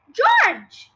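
loud call from the next room; from another room. A narrator's reading. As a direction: yell it as if through a wall. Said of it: "the awful voice from the next room".

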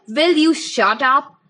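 loud, slow outburst at a classmate. A narrator's reading. Boy snapping at a snickering classmate in class, loud and slow. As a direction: blurt it out too loud and slow. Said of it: "It came out louder than I meant to".

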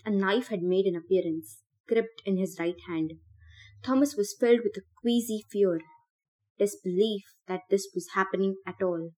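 plain reading voice

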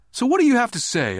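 put-on high, loud demanding kid; a brave little boy insisting. A narrator's reading. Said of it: "I demanded".

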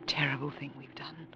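hushed whisper of guilt; whispered confession to her beloved. Acted. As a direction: whisper it, barely voiced.

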